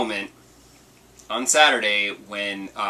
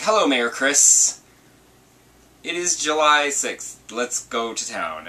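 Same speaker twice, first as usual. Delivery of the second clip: slow, higher sing-song game greeting; game character voice; 5 semitones higher, 3 dB louder, slower than usual. Retold or imitated. Game character greeting the player, slow and higher. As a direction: lightly play the cheerful game villager.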